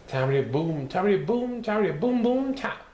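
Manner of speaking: rhythmic drum sounds, rising each time; drum rhythm chant. Acted. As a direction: tap it out like a drummer. Imitating drumming on knees.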